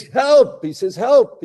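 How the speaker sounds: high, loud calls of help; hanging climber begs God for help, high and loud; mock cry for help. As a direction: plead upward, hammed-up.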